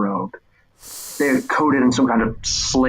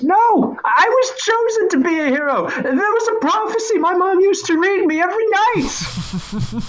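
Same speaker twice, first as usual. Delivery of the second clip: put-on much higher, earnest boy voice with a laugh; proud kid reciting his destiny; boy hero. Boy insisting to the figure he is chosen.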